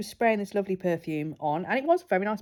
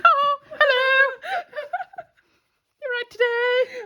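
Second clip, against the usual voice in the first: squeaky perfume cloud. Pretend. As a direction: play a giddy talking cloud of scent, way up high and laughing.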